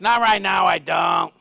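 lightly hollered impression of a street man; imitated homeless man. Homeless man refuses water again, imitated hollering. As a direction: mimic his shouted refusal, easing off at the end. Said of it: "That's how he talks".

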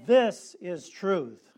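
very slow, loud "This is truth", THIS punched; emphatic. Shown as a demonstration. Preacher drives the refrain home, slow and loud. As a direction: declare it like a verdict. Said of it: "Let's say it with emphasis".

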